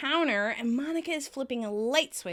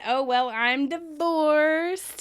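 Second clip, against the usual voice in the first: slow, drawn-out, higher character voice; Janice; 4 semitones higher, 3 dB louder, much slower than usual. Retold or imitated. Janice answering how have you been, drawling imitation. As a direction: stretch it out, pitched up.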